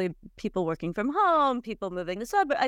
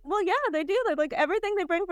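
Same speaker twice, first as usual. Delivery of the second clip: daughter answering; 9 semitones higher, much faster than usual. Retold or imitated. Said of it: "And she was like".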